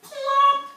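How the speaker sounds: high, loud cartoon plop; bright plop for little listeners; plop sound effect. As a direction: pop the landing out high and loud. Frog lands on lily pad.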